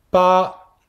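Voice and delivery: "Pa" with a sound between P and B, high; Mandarin ba. Shown as a demonstration. Teacher models "Pa" for students: high level tone. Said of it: "Le B entre le P et le B".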